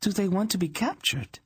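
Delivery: put-on high, alarmed girlish voice; young princess. Princess questions Dernik about enemy prisoners, alarmed.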